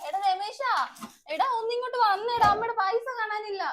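high, loud panicked call to her son. Acted. Mother shouting for her son about the missing money, loud and high. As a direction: yell for Ramesh from the other room.

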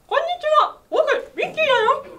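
very high, loud squeaky mouse voice; Mickey Mouse impression. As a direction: pinch the nose and squeak like Mickey. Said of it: "ミッキーいきます". (鼻をつまんだ甲高いミッキーの声)

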